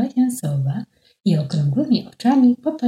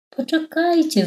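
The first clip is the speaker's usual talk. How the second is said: high call while flying; 11 semitones higher than usual. Acted. Stork in heavy clothes calls to the flock, high call. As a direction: call out, pitched much higher. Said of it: "wołał".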